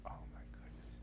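barely audible whisper; acted hushed dread; whispered dismay. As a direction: whisper it to yourself, in trouble. Scared reaction to cop's threat.

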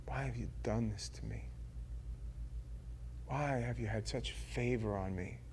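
soft, low, wondering; awed question. Acted. David marveling quietly to God over his favor. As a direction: hush your voice as if amazed at grace.

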